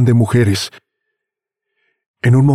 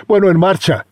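higher, barking order; shouting; 9 semitones higher than usual. Acted. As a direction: yell at the driver to go. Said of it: "le grita John".

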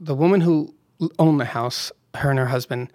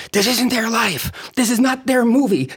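higher, slow, insistent objection; indignant protest; 9 semitones higher than usual. Retold or imitated. Actress Kathy objecting to the director, higher drawn-out protest. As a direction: drag out the protest a little, mock indignant. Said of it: "Kathy would come to me going".

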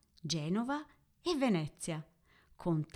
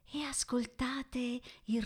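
whispering; 5 semitones higher, 4 dB quieter than usual. Acted. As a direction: whisper it, as if hearing waves. Guided imagining of the sea.

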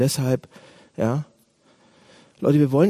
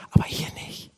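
sudden hushed, softer aside; whispered reservation; 6 dB quieter than usual. Acted. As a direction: slip it in under your breath. Acted secret reservation after loud praise. (heimlich geflüsterter Einwand)

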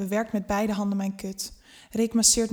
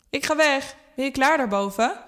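called up the stairs, raised and higher; calling out; 5 semitones higher, 4 dB louder than usual. A narrator's reading. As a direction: call up to her from below. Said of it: "roept Rick".

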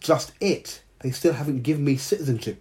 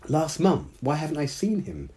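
high surprised exclamation; exclaiming; 7 semitones higher than usual. A narrator's reading. Hannah to Dao about her brother, surprised exclamation. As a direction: pitch jumps up on last month. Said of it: "Hannah exclaimed".

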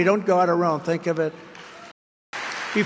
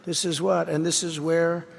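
ordinary talk, nothing marked

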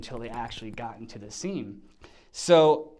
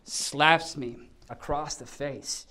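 ordinary talk, nothing marked

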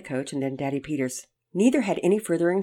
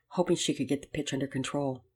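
plain reading voice

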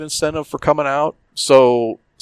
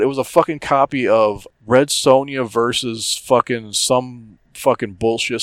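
normal talking voice

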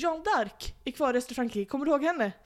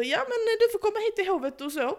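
mock courtier; 11 semitones higher, 4 dB louder than usual. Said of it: "de säger så".

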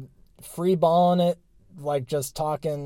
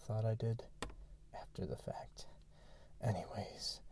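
whispering; 4 semitones lower, 15 dB quieter than usual. Real, not acted. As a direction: whisper it, barely voiced. Kids asleep, speaker whispering.

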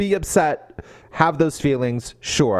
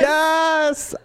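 much higher yes; excited cheer; 14 semitones higher than usual. Real, not acted. Joining a co-host's cheer over a book scene, high and excited. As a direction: squeal a joyful yes.

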